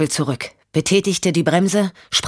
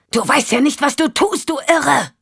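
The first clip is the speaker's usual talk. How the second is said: much higher, yelled insult; Champ, raging; 9 semitones higher than usual. Acted. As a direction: rage after the madwoman. Champ yells at fleeing Michelle.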